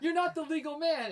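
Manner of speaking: high, drawn-out heckle; heckling shout. Retold or imitated. Fan to wrestler in the ring, high heckling call. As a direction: call him out from the seats. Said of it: "I was heckling him so bad".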